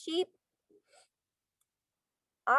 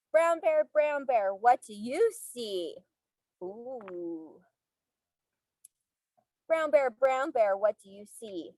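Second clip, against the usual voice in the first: sing-song storybook reading; reading to children; 2 semitones lower than usual. Acted. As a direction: read aloud to little kids. Reading picture book to class.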